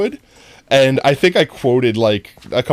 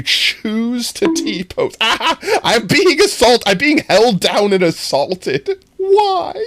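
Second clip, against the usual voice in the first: higher, slow, laughing through the words; laughing protest; 9 semitones higher, much slower than usual. Real, not acted. Streamer laughing at chat's trolling, pitched-up giggling protest. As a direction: giggle out a helpless complaint.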